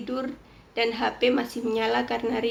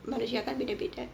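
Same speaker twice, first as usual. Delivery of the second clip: usual speaking voice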